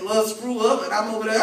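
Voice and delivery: usual speaking voice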